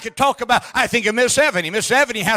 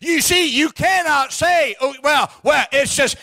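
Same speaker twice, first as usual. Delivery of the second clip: preaching at full force; 4 semitones higher than usual. Acted. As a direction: preach it hard to the congregation. Preacher mocking an excuse.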